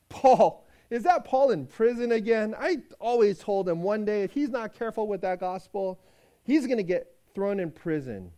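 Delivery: put-on loud, lively outburst over an old friend; loud fond exclamation about Paul; excited exclaim. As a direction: burst out, half scolding, half fond. Master exclaims over Paul's letter.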